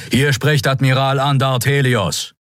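lightly voiced admiral's warning over the channel; firm warning to the enemy ships; stern admiral. Admiral warns the attackers over the open channel, stern.

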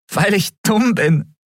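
high, slow, tearful reply; laughing and crying. A narrator's reading. Woman admitting her mistake to Kai, laughing and crying at once. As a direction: answer between laughter and tears. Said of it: "lachte und weinte gleichzeitig".

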